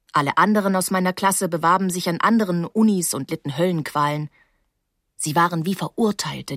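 usual speaking voice

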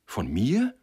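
startled question, sharply rising; dismayed cry. A narrator's reading. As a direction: blurt it out, taken aback. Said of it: "rief Sender bestürzt".

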